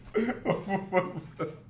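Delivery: helpless real laughter. Real, not acted. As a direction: keep laughing, unable to speak. Laughs at the clip.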